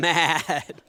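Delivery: ordinary delivery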